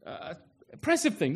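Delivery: ordinary delivery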